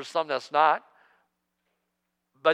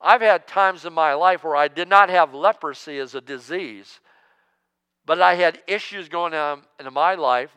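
normal talking voice

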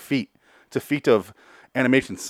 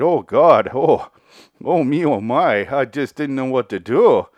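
loud, high, wailing Canadian caricature; hammed-up terror in a thick mock Canadian accent; Uncle Harry; 5 semitones higher, 7 dB louder than usual. Uncle Harry describing the snake and pendulum, overwrought Canadian wail.

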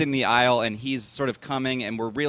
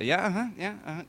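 higher, absent uh-huhs, lightly voiced; politely dismissive; 8 semitones higher, 4 dB quieter, faster than usual. Imitating the director humoring a fan, mild. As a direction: brush off a fan without interest. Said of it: "would just sort of".

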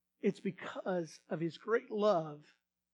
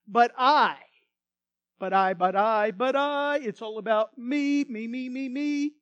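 mocking self-centred whine; voice of sin; 3 semitones higher, 10 dB louder than usual. Preacher voicing sin's self-centred cry to congregation. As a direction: mimic the selfish voice of sin, loud and insistent. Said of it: "sin says this".

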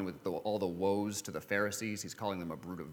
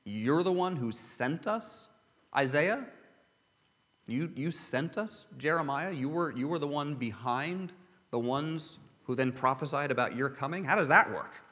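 higher, halting incredulous questions; baffled disbelief; 5 semitones higher than usual. Acted. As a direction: stop and question it, pitch climbing in disbelief. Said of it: "you wanna stop and say".

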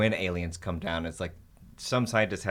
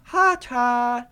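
put-on high, loud toddler babble; 7 dB louder than usual. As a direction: squeal it like a little kid. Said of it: "she used to say".

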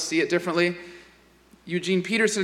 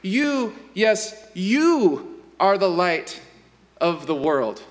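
single out the listener on "you"; emphatic you; 2 semitones higher, slower than usual. Shown as a demonstration. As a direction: stress each "you", slowly and deliberately. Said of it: "it comes out more like".

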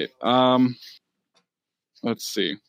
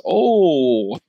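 bartender voice; 4 semitones higher, 6 dB louder than usual. Acted.

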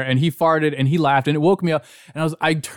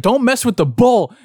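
higher, louder retold threat; mock threatening warning; 9 semitones higher, 5 dB louder than usual. Imitating a friend warning John after the tirade, threatening. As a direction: belt it out like a fed-up friend. Acted quote of Zach's threat.

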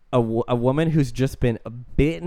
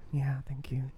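whispered, barely voiced thanks; faint mock-dazed reply; 11 dB quieter than usual. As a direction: answer in a small, airless whisper. Retelling her dazed reply to strangers.

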